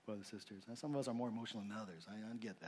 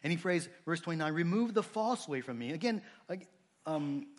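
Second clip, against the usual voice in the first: louder, higher, driving sermon voice; fervent preaching; 6 semitones higher, 7 dB louder than usual. Real, not acted. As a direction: preach it out with urgency. Preaching at full force.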